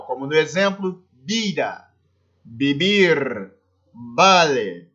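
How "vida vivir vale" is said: "vida, beber, vale" each starting with a b sound. Shown as a demonstration. Teacher showing Spanish V, pronounced B in "vida, beber, vale". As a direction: say "vida" as bida, "vale" as bale. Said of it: "tem som de B no espanhol".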